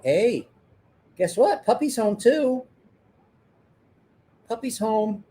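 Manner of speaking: high, bright announcement to the pets; excited pet talk. Real, not acted. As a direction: cheerfully tell the animals. Greeting the pets.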